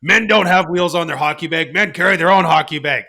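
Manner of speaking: macho dad. Retold or imitated. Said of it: "I'm like".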